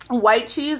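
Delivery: normal talking voice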